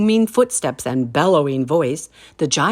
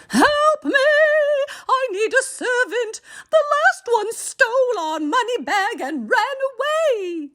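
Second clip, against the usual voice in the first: giantess roaring. A narrator's reading. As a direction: shriek for help like a frantic giantess.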